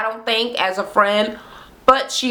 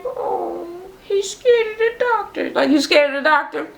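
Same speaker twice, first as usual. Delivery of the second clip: pouty, high-pitched aww voice; cooing sympathy; 9 semitones higher than usual. Retold or imitated. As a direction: coo over a scared little one. Babying a scared child, cooing.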